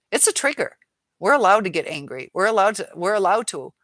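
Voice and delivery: a real flare of irritation; heated. Real, not acted. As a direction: push back hard and loud. Heated rant at a commenter.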